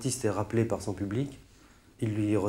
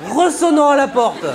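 high, slow, loud comic yell; indignant drawn-out shout; mock exasperation; 14 semitones higher, 10 dB louder than usual. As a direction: drag out the absurdity at full volume. Mock outrage, absurd plumber example.

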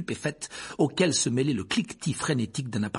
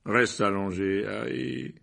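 soothing voice; slower than usual. A narrator's reading. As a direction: lull him into lying still. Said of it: "d'une voix apaisante".

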